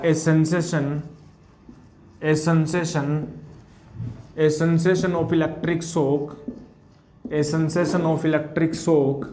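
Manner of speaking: very slow, each word spaced; slow dictation. Shown as a demonstration. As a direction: dictate slowly for students to copy. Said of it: "dictating notes slowly".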